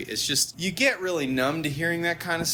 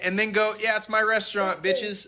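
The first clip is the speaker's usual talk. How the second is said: cheeky power move; 6 semitones higher than usual. Retold or imitated. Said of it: "I was like".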